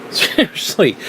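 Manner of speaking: real breathy laugh; laughing seriously. Real, not acted. Laughing disbelief.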